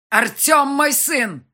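loud, high, drawn-out roar; roared bark. A narrator's reading. Father claiming Артём as his son, roaring. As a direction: roar it back angrily. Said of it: "рявкнул Ян".

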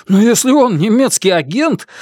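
high, urgent objection; worried corporal. A narrator's reading. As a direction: cut in, alarmed. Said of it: "начинает Бенджамин". (встревоженный капрал)

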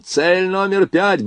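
high, drawn-out military order; slow, high-pitched commander's shout; loud command. A narrator's reading. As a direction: call the target out to the crew. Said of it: "громко скомандовал он".

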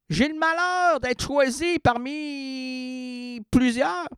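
high-pitched, incredulous outrage; rising indignation. Real, not acted. Customer fuming over customs fees on a parcel, indignant. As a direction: let the exasperation push the voice up high. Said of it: "que ma rogne prend son essor".

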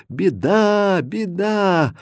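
high, long-drawn wail without full volume; acted villagers' lament; crying out in distress. A narrator's reading. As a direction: wail the alarm mournfully. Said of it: "с криками да плачем".

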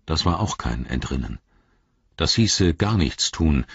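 plain reading voice

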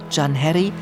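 ordinary talk, nothing marked

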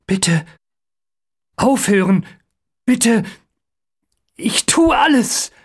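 broken-up pleas rising in pitch; desperate begging. A narrator's reading. Captive begging amid the spiders.

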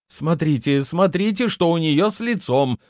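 high-pitched, panicky urging; agitated alarm. A narrator's reading. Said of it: "Лунта задышала чаще".

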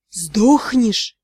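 deep, low questioning voice; sea god. A narrator's reading. Sea god asks the heroine again. (низкий голос морского бога)

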